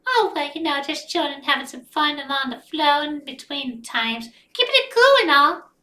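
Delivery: high put-on voice; character voice. Narrator voicing Rosie.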